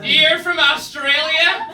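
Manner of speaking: high, slow, gushing mock fan voice; hammed-up starstruck woman gushing; excited American fan. Audience member gushing at the comedian, high and drawn out. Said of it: "and she was like".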